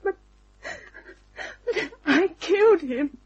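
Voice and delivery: choked-up guilty cry. Acted. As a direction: sob out the confession. Confession, crying.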